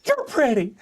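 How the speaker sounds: self-flattering woman. Pretend. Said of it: "I look in the mirror and I go".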